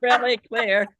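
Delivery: laughing through the words; amused at her own joke. Real, not acted. Joke about Vegas.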